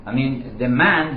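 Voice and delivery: ordinary delivery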